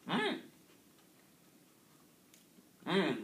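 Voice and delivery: talking while chewing; finishing a mouthful; mouth full chewing. Real, not acted. Seen 0: cookie put in mouth at -4; lips pursed, chewing until 0.8.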